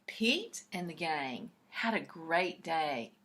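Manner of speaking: ordinary delivery, nothing marked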